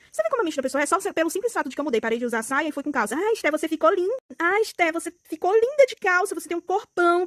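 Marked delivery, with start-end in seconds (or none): 3.1-7.2 high, gushing fake compliments; mock sugary praise, pitched up; fake flattering girls. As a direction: gush like two-faced classmates. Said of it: "queria se achegar a mim".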